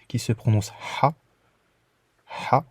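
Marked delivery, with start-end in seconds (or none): 2.5-2.6 "Ha" pushed out with a hard breath; strongly breathed h. Shown as a demonstration. Teacher demonstrating the breathy h, strong exhale. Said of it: "un H fortement expiré".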